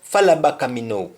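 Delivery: "Sanya, fala baka me know" fast, in a Jamaican Patois lilt; a quick Patois call to follow. Shown as a demonstration. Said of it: "the example is".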